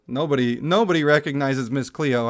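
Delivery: ordinary delivery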